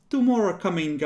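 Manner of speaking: ordinary delivery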